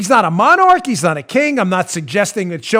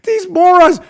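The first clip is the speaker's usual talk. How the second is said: exasperated; 11 semitones higher, 7 dB louder, slower than usual. Acted. Exasperated rant.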